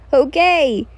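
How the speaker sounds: agree, still half yelling. Acted. Kojo told to stop yelling, still yelling. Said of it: "you're still yelling".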